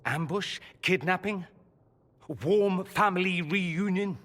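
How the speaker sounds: rising mock guesses, last one drawn out; sarcastic accusation. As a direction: taunt father with each guess, pitch climbing. Son confronts father with sarcastic guesses.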